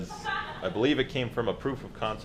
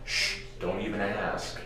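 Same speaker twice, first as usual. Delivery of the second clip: hushed; 5 dB quieter, slower than usual. Acted. Secretive hush to camera.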